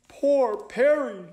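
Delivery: high, slow lament; drawn-out high sympathy; concerned owl detective. Acted. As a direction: pity the victim in a high owl voice.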